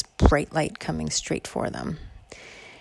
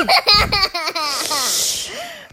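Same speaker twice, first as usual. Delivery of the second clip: high giggle; 13 semitones higher than usual. Real, not acted. Teller laughs in voice.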